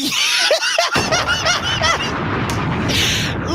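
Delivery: long, helpless high laugh; high-pitched laughter. Real, not acted. Dad cracking up over his crying son, high laughter.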